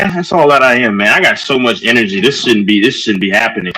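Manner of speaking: ordinary talk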